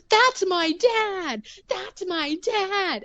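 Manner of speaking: high, slow, drawn-out proud cry; hammed-up proud child; proud kid. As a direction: savor it like a beaming little boy. Proud kid in final scene.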